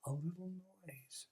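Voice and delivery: soft little whisper. Acted. Rabbit whispering to himself, softly. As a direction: say it softly under the breath. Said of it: "whispered the rabbit".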